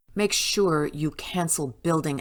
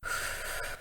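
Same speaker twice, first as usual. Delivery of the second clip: weary sigh; 10 dB quieter than usual. Acted. Boss weary at troublesome scientist.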